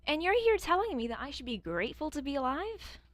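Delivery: loud, high, incredulous outburst; outraged. Real, not acted. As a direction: blow up in disbelief. Outraged reply to being told grateful.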